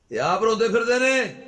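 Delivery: high, very loud preacher's cry; soaring, loud repeat for emphasis; emphatic shout. Real, not acted. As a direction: hammer the point home at full volume.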